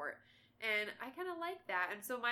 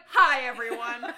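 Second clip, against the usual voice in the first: slow, louder, put-on greeting; mock announcer; 3 semitones higher, 16 dB louder, slower than usual. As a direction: drawl hi everyone like a politician.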